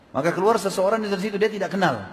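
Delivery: ordinary delivery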